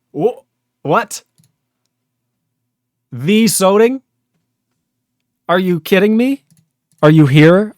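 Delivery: high, loud and incredulous; can't believe who just showed up; surprised disbelief. Real, not acted. Streamer spots a famous viewer.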